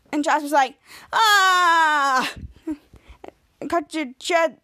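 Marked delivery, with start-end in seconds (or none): 1.1-2.3 long, loud acted cry of pain; pained yell. Jasper burned by boiling meatball water, crying out. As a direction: let out a drawn-out scalded "ah".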